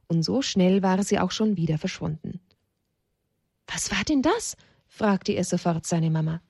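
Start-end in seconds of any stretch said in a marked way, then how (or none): 3.7-4.6 put-on high child's voice, surprised question; startled little child. Child asks Mama about the snake, high startled voice. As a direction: ask up high like an astonished small child.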